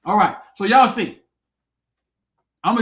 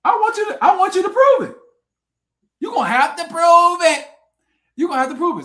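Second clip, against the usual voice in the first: much higher and louder, demanding proof; 8 semitones higher, 6 dB louder than usual. Real, not acted. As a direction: shout the challenge up high.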